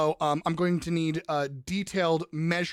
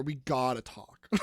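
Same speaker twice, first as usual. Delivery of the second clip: mock ominous; 8 semitones lower, 5 dB quieter, slower than usual. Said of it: "I didn't say we had to have a bad talk".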